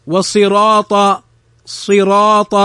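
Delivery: slow صراط with a thick r and long dark vowel; heavy ra. Shown as a demonstration. Teacher models صراط from recitation, heavy ra. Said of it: "الراء مفتوحة فنفخمها".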